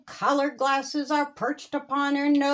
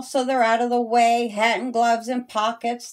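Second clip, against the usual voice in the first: ordinary delivery, nothing marked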